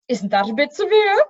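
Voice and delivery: frowning. A narrator's reading.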